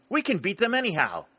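high, quick, confident outburst; fast, high-pitched rallying cry; eager exclamation. A narrator's reading. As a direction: exclaim it to hearten a despairing officer. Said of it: "he exclaimed".

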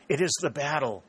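narrated cry of alarm. A narrator's reading. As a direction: cry it out as sudden realization. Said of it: "she cried".

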